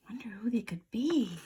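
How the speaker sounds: hushed, quick whisper of mystery. Acted. Reader teasing the children, whispered suspense. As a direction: lean in and whisper a secret. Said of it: "reader whispers to listener".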